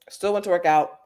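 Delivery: ordinary talk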